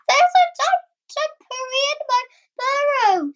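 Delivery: high-pitched panicky squeal; alarmed. A narrator's reading. Frightened Rabbit tells Cat about the intruder, high alarmed squeal.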